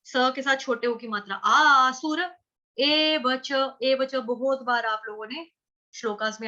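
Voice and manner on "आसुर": "आसुर" loud and slow, ending on a full "रə". Shown as a demonstration. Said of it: "र पूरा". ("आसुर" पूरे र के साथ, ज़ोर से)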